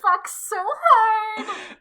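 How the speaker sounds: high, drawn-out, loud delighted cry; overwhelmed delight. Real, not acted. Co-host hearing the Sailor Pellegrino joke, real high-pitched delight.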